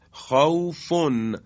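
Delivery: خوفن said very slowly, its long vowel held; slow, drawn-out recitation. Shown as a demonstration. Teacher recites خوفن slowly for students, vowel stretched.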